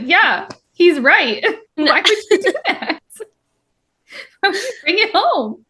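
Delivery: hammed-up scolding with a laugh in it; mock exasperation. Reader siding with Elroy against Saris, mock exasperated.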